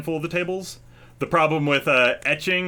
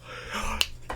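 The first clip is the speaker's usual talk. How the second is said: sharp gasp; 9 dB quieter than usual. Acted. Drunk woman gasps in recognition.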